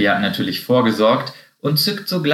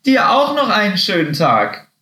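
high call falling away at the end; calling after someone; 9 semitones higher, 4 dB louder than usual. A narrator's reading. Guinea pig to departing Happy, calling after him. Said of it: "rief ihm Meerschweinchen Hamlet hinterher".

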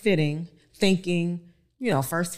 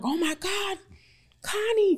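excited; 13 semitones higher than usual. Retold or imitated. Consultants react to pictures.

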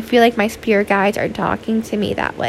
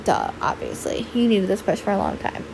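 a low, soft imitation of know-it-all friends; mock friends' duh; 3 semitones lower, 7 dB quieter than usual. Imitating her friends telling her it was obvious, low soft mock-exasperated. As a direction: drop low and quiet, playing the friends who saw it coming. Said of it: "my friends are probably like".

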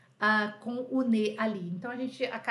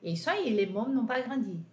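normal talking voice

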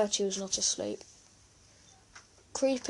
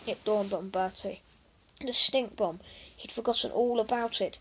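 usual speaking voice

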